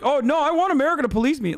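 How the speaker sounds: mocking people who want America as police; mocking whine. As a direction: whine it like a naive fan begging. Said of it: "These motherfuckers are like".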